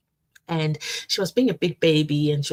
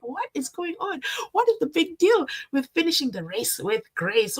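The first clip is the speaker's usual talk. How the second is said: confused viewer; 10 semitones higher than usual. Retold or imitated. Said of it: "those who are watching ... are like".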